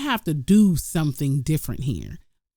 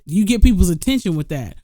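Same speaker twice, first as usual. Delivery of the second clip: ordinary talk, nothing marked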